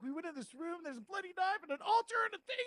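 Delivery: high-pitched retold alarm; mock freaked-out. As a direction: blurt it out, imitating panic. Retelling their freaked-out report.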